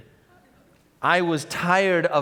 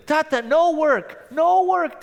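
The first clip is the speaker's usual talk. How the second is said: put-on high, insistent little child; a toddler begging daddy not to go; 10 semitones higher, 3 dB louder than usual. Toddler telling her father not to go to work, high child voice.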